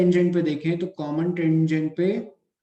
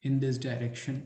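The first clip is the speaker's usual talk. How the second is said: slow, measured classroom dictation; word by word, with pauses to write; 4 dB quieter, much slower than usual. Acted. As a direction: dictate in short phrases for note-taking. Dictates points for students to write.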